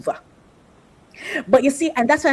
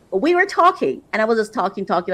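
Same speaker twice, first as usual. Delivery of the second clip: ordinary talk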